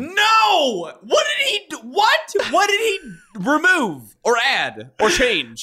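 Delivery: high, loud comic outburst of disbelief; screaming. Real, not acted. Co-host stunned by seven-year-old's surgery, screaming in disbelief. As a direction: scream in escalating, incredulous questions. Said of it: "I'm gonna scream".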